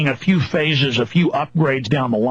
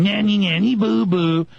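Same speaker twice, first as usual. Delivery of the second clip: singsong nanny nanny boo boo, lightly mocked; mocking childish taunt; 4 semitones higher than usual. Mocking officials as children, light childish taunt. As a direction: taunt like a bratty kid, half-hearted. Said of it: "acting like children going".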